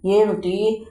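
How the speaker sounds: ordinary delivery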